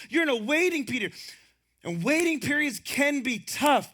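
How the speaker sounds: usual speaking voice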